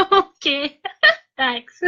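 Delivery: laughing through the words; giggling thanks. Real, not acted. Laughing at the quote.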